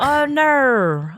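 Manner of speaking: Australian "Oh, no" pitched high. Shown as a demonstration. Demonstrating the Australian "oh no" to friends. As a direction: drawl "Oh, no" in an Aussie accent. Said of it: "they say".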